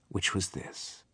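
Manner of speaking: very soft whisper; whispered lead-in to the answer. A narrator's reading. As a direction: hush before the reveal. Hushed build to the answer.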